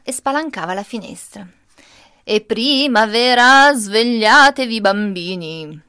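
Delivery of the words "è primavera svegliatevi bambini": grandpa's booming wake-up call, slow and loud. Retold or imitated. Grandpa throws open the window to wake the child, booming. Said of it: "si metteva a cantare".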